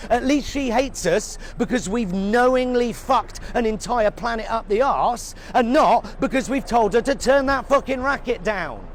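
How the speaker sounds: ordinary talk, nothing marked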